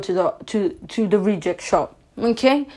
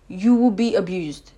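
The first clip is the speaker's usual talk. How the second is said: ordinary talk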